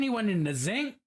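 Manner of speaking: Baltimore accent, sink said as zinc; mock Baltimore accent, zinc for sink. Shown as a demonstration. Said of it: "That is not a particularly Balmer accent".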